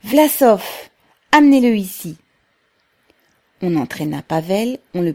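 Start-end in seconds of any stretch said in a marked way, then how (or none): 0.0-2.2 higher, louder call across crowd. A narrator's reading. Workers calling for Pavel to be brought, shouting. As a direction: summon him from the crowd.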